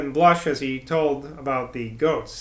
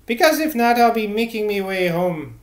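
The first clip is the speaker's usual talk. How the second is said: put-on higher-pitched rustic old woman; 5 semitones higher, 4 dB louder than usual. A narrator's reading. Old church cleaner asking to be dismissed, high-voiced. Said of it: "the old woman responded".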